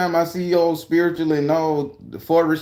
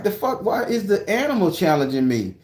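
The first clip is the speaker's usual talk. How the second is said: higher-pitched baffled outburst; acted indignation; 7 semitones higher than usual. Recounting a squirrel's challenge, acted indignation. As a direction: demand answers in mock disbelief.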